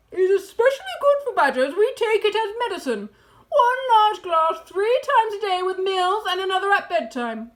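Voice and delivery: loud, high, eager Badger; eager enthusiasm. A narrator's reading. Badger praising cider as medicine, loud and eager.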